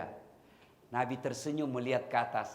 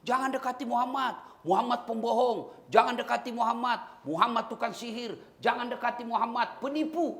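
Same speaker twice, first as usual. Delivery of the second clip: shouting blind man; 9 semitones higher than usual. Retold or imitated. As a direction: cry it out across the market. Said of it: "Teriak-teriak".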